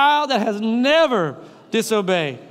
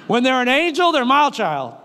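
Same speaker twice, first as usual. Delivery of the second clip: usual speaking voice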